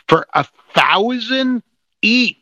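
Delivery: high-pitched, incredulous emphasis on the sum; indignant. Real, not acted. Host reporting the key's sale price to co-host, indignant. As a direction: land each word, pitch climbing. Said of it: "we're really not this angry".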